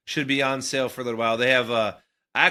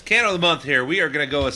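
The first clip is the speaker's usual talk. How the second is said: quick, louder radio-host read; fast host intro; 3 semitones higher, 5 dB louder, much faster than usual. Acted. Opening the candle segment for listeners in a fast, louder host read.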